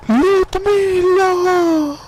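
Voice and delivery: high, long-drawn yell; shouted call. Acted. Boss yelling for the slacking worker, drawn-out shout. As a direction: yell the name out, stretching it right up high. Calling out angrily to Ludmilla.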